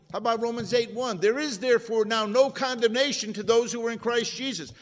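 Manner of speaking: loud, high preacher's climb; rising, fervent pulpit call; full-force preaching. Acted. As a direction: lift the room, piling up each blessing. Preaching builds to a peak.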